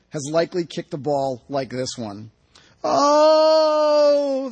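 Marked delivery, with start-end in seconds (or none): 2.8-4.5 long, high, loud "ohhh"; crowd's cry. A narrator's reading. Crowd seeing the kick miss, long loud cry. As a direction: wail it like a whole crowd at once. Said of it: "the crowd and players cry as one".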